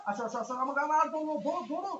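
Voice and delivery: high, loud hurried calls; excited urging. Real, not acted. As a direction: push them on, breathless. Urging teammate during fight.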